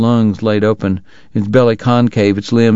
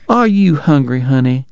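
much higher, motherly Georgia belle; doting wife greets the ragged traveler, high and fussing; fussing Southern lady; 4 semitones higher than usual. Acted. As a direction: coo over the sick boy in a high voice. Missus Segar mothers him.

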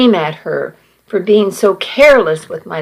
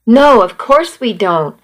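ordinary delivery, nothing marked

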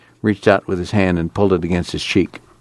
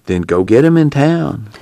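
put-on a slightly higher, glib young clerk; lightly voiced store clerk; 7 semitones higher, slower than usual. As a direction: sell it to her, a bit smug. Clerk snaps back.

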